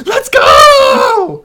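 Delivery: high, full-throated team yell. Retold or imitated. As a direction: scream it like a pumped-up squad. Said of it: "chorus of guys yelling".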